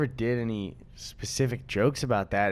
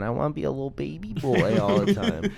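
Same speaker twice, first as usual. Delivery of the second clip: put-on higher whiny baby-boy voice, laughing; 6 semitones higher than usual. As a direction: whine like a big baby. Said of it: "walks around the apartment going".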